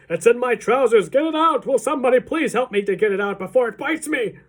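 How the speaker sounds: high, loud, pleading cook; desperate comic holler; panicked shouting. A narrator's reading. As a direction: beg for rescue at a frantic yell. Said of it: "cook still shouting".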